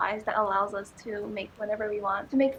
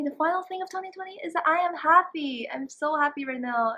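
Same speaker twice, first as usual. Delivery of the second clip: ordinary delivery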